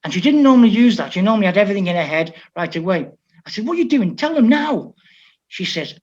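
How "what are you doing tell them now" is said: much higher, urgent coach demand; impatient. Retold or imitated. Coach urging the translator to pass on the order, higher and impatient. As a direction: hurry her up, impatiently.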